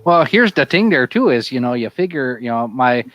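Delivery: hammed-up Yooper Wisconsin accent, "you betcha there, eh?". Bragging about deer camp to friends, Yooper accent.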